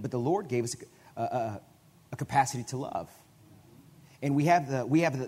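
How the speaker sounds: normal talking voice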